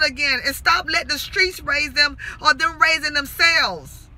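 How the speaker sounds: loud, forceful plea; impassioned preaching. Real, not acted. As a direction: exhort them like a fired-up preacher. Impassioned plea to youths and parents.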